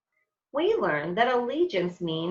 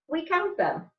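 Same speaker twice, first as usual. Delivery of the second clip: put-on kid voice; 10 semitones higher than usual. Children counting stripes.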